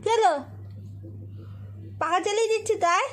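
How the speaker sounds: high, loud pet talk; talking to the dog. Real, not acted. Girl coaxing her dog onto the bed, high loud pet talk.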